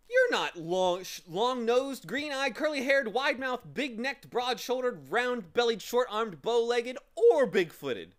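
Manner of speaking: high, loud, rattled-off list; affronted, high-pitched debunking; indignant. A narrator's reading. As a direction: reel off each feature in high indignation. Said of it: "said Milo indignantly".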